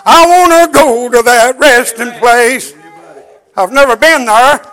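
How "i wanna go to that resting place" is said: chanted, slow preacher's whoop over music; preaching at full force. Acted. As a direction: lift it into a sung sermon cadence.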